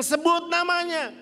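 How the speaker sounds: high, loud, slow push to say the name; insistent command. Retold or imitated. Preacher urging the man loudly to name Hans. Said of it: "Saya bilang".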